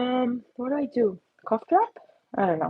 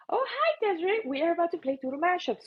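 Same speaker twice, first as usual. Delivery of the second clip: ordinary delivery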